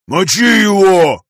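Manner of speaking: slow, loud booming roar; booming amplified voice. Acted. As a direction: thunder it out like a giant. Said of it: "прогремел голос Васи, усиленный в несколько раз".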